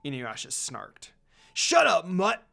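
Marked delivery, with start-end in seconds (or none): 1.5-2.4 sudden loud snapped insult; angry snap. Acted. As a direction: bite his head off. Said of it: "Koga snapped".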